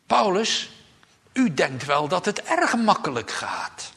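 loud, high mocking retort. King Agrippa scoffing at the apostle Paul, loud and mocking. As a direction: sneer it at Paul, scoffing loudly. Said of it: "Hij zegt het spottend".

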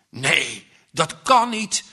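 hoog, luid en wanhopig; innerlijke schreeuw. A narrator's reading. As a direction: roep het uit in paniek. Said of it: "schreeuwt het in zijn jongenshart". (wanhopige kreet)

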